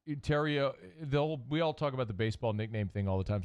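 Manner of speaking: normal talking voice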